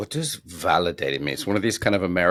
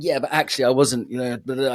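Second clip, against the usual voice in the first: higher, quick defensive protest; getting defensive; 5 semitones higher than usual. Retold or imitated. Man answering girlfriend's feedback, defensively protesting. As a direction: push back before they finish. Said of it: "I get defensive".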